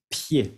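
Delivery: Pied said pje, D and S silent; French pronunciation. Shown as a demonstration. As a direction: clean pied, nothing at the end. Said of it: "No vayan a pronunciar ni la D ni la S".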